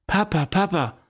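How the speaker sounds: put-on mild child's cry across the meadow; child's scream. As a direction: call out to papa as a little girl in a hurry. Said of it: "schrie Petala".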